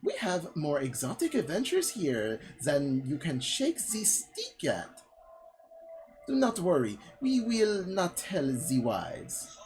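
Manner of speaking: hammed-up French accent, high lilting swoops. Hostess welcoming visitors to the Outrealms, hammed-up French accent. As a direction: lay on a ridiculous French accent, drawing words out. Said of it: "my voice just goes from French stereotype".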